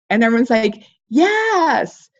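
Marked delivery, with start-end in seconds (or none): 1.1-2.1 eager audience yes. Pretend.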